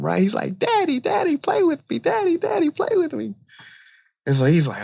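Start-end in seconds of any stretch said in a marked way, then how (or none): 0.6-3.3 put-on very high-pitched little kid voice; pestering child. Young son begs dad to play during the game, pestering. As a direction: whine like a small boy nagging for attention. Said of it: "kept annoying him".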